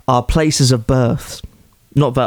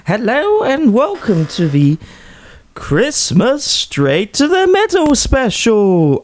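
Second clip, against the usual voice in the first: high, slow, sing-song lady voice; old lady or young girl voice; 8 semitones higher, slower than usual. Acted. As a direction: lift it up into a sweet old lady's voice. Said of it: "an old lady or a young girl voice there".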